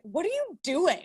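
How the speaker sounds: a hint of a bewildered son; puzzled child. Son questioning tearful parent.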